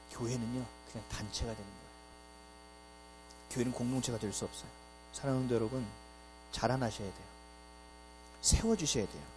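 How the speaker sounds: a pastor's tender closing appeal; earnest sermon close. Acted. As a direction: plead gently with the congregation.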